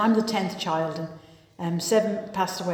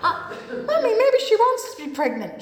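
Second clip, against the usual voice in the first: higher, lightly voiced naive child; innocent daughter; 13 semitones higher than usual. Daughter questioning her mother on the escalator, high and innocent. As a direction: pipe up to Mummy, half laughing.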